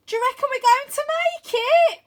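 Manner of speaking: high, loud frightened question; scared. A narrator's reading. Wyn asking Arthur if they will make it, scared. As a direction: ask it with fear in your voice. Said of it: "Wyn's voice sounds strangely scared".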